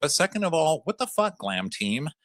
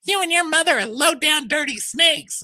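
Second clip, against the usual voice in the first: high, loud mimicking of Portia; hammed-up squeaky snipe at a rival; mock Portia insult; 7 dB louder than usual. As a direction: housewife voice sneering at her costar.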